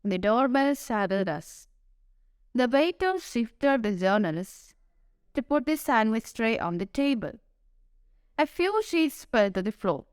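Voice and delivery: plain reading voice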